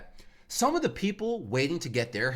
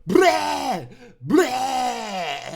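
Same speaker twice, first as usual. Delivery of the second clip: high, loud imitated goblin screech; goblin-like shriek; 9 semitones higher, 7 dB louder than usual. Comedian imitating the tortured kid's scream, goblin screech. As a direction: screech out a monstrous mock cry. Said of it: "It sounded like a goblin".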